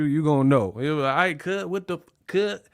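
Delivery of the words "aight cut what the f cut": mock LA anger. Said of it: "If you go to L A and they mad at you".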